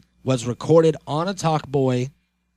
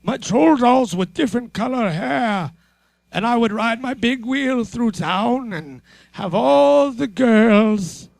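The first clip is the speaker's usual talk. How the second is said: put-on high, slow old man voice; boastful old geezer reminiscing; 8 semitones higher, slower than usual. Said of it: "do the rest of the show as old men".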